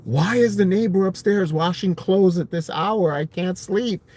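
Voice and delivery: mildly acted grievance to mom; complaining. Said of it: "complaining to my mom".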